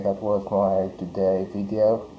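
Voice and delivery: ordinary talk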